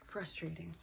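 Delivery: sighed whisper; whispered, fed-up word. Real, not acted. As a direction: let it out on a tired whisper.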